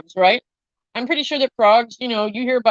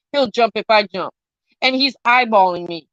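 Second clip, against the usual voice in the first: ordinary delivery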